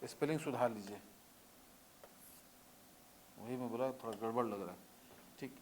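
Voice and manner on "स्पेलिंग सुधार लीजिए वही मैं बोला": normal talking voice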